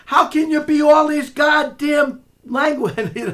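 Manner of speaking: exasperated yell at the rival; angry shout. Real, not acted. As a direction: voice jumps high and loud mid-rant. Ranting angrily at the man.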